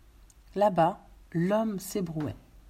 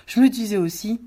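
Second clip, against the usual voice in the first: rire entendu; 4 semitones higher, 9 dB louder than usual. Acted. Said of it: "il ajouta avec un rire entendu".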